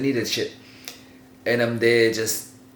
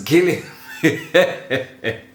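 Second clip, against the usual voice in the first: real laughter taking over the words; slow down and crack up mid-sentence; breaking into laughter; 4 semitones higher, much slower than usual. Real, not acted. Storyteller telling an embarrassing childhood story, cracking up.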